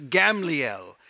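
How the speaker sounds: Gamliel drawn out, with no second A; Hebrew pronunciation. Shown as a demonstration. Preacher concluding the name lesson, Gamliel said the Hebrew way without a middle A. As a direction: say Gamliel as gam-lee-el, slowly. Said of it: "the second A wasn't even there".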